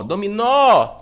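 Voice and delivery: dominó with the final nó punched and lengthened; stress on last syllable. Shown as a demonstration. Teacher showing the sílaba tônica, stressed nó in dominó. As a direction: lean hard on nó in dominó. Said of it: "Sílaba tônica, nó".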